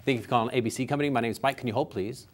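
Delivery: fast, rushed receptionist brush-off; hurried greeting before putting the caller on hold; rushed phone answer. Shown as a demonstration. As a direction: rattle it off like you're too busy. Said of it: "Let me give you an example".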